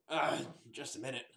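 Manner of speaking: just-woken, sleepy mumble; a half-awake man calling through the door; groggy morning voice. Acted. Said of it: "that groggy morning voice".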